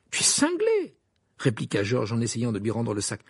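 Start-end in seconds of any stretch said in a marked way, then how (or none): 0.1-1.0 high-pitched stunned exclamation; incredulous. Acted. George handing the sack back, incredulous. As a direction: blurt it out in disbelief, voice leaping up.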